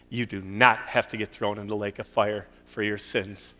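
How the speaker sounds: ordinary talk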